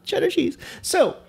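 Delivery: very high squeaky delight; giddy excitement. Pretend. Food fan relishing the cheese option, giddy high squeal. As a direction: squeal with glee.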